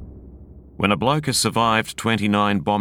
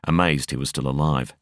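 plain reading voice

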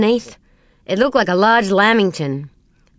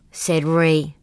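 plain reading voice

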